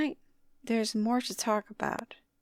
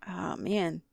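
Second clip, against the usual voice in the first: ordinary delivery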